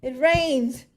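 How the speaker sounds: normal talking voice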